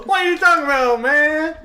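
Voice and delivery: high, loud mock echo of a caller; mimicking the clip. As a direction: parrot it back high and loud, mockingly. Echoing the played clip's voice.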